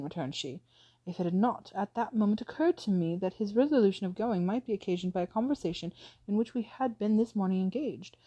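ordinary delivery

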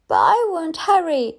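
put-on high little girl's plea; tearful child. A narrator's reading. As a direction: plead high, like a child near tears. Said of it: "Again with the tears".

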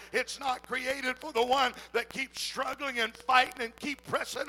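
loud, rising preacher's cry building to a shout; preaching at full force. Acted. Preacher rousing the congregation, loud and fervent. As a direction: pour it on like a revival preacher.

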